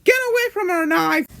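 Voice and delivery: very high-pitched, angry squeal; angry marshmallow. Acted. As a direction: squeal it in high, fast outrage. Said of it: "gets me angry and she says".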